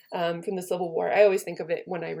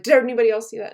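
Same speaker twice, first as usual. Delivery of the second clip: loud, higher rattled question; alarmed call; 4 semitones higher, 10 dB louder, faster than usual. Acted. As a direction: rouse the whole tent in a panic. Brutus wakes his men, shaken.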